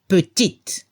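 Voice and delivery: petite with a clear final t; petite, sound the t at the end; final T heard. Shown as a demonstration. Teacher correcting the quiz answer. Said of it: "se escucha la T".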